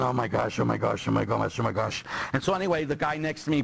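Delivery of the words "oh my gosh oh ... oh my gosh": low, nervous self-muttering; mock panicky inner chant; nervous wreck. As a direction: repeat it under the breath, lightly panicked. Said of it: "I'm thinking".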